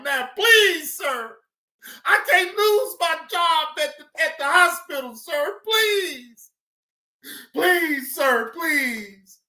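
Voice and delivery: whiny, high-pitched imitation of begging; mock pleading. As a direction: beg theatrically, mocking the suspect. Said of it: "Here come the begging and pleading".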